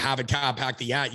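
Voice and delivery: put-on broad Boston accent, dropped r's; South Boston accent. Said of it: "somebody in South Boston".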